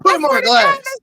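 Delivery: high, loud hammed-up command; mock cop shout. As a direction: bark it like a cop. Playing the arresting cop.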